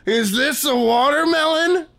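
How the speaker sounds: squeaky puppet doing Carrot Top, asking the question dumbly. Retold or imitated. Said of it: "use the other puppet, Carrot Top, to grab a cantaloupe and go".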